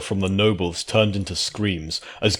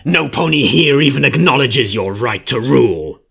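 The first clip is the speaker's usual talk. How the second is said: louder spiteful creature voice; 5 semitones higher, 8 dB louder than usual. A narrator's reading. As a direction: sneer at her claim. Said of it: "the changeling spat".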